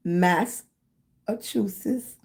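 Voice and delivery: "Massachusetts" stretched out slowly, each syllable careful; slow, deliberate "Massachusetts". Shown as a demonstration. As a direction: drag "Massachusetts" out slowly so it comes out right. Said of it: "I have to say it very slow".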